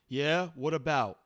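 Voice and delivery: high, loud bored boss; gruff boss voice. Acted. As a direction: toss it off like a lazy boss. Boss voice answering.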